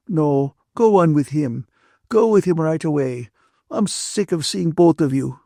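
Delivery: high-pitched, peevish dismissal; upset Ferguson, dismissing them. A narrator's reading. Ferguson orders the couple to leave her, upset. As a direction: send them away in a huff.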